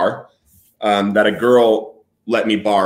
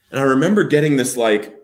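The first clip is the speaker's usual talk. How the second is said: normal talking voice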